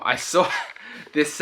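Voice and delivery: ordinary talk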